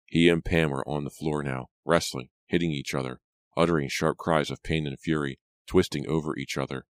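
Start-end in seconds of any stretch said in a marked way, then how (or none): none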